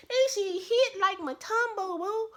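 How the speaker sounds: very high, slow squeal; squealed punchline. Real, not acted. Vlogger hyping Stella Rosa, in a high squeal. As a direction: squeak it out for the laugh.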